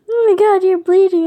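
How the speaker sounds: high, loud, startled; alarmed cry. Acted. Finding a wounded friend bleeding, high alarmed cry. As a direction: gasp it out at the sight of blood.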